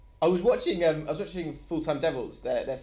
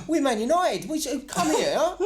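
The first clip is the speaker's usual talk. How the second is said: high-pitched Manchester fan impression; mock Manc accent; 9 semitones higher, 4 dB louder than usual. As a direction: mimic a Manc fan protesting, high and laughing. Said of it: "in that accent".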